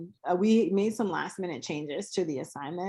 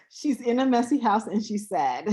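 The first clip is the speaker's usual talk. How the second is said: amused, higher voice breaking into laughter; laughing; 5 semitones higher, 3 dB louder than usual. Real, not acted. Host describing a sad stick figure drawing, laughing. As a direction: say it through a laugh, then crack up.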